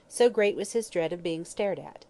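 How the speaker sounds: ordinary delivery, nothing marked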